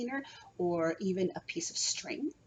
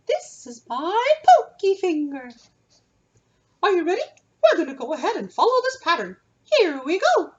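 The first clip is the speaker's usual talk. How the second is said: high and loud, sung-out speech; head voice; 9 semitones higher, 11 dB louder than usual. Shown as a demonstration. Teacher talks in head voice to lead the tracing game. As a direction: keep it all up high in the head. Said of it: "use our head voice for this activity".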